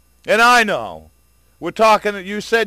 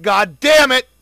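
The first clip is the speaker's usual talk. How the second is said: angry curse; 5 semitones higher, 6 dB louder, slower than usual. Real, not acted. Furious rant about hidden data.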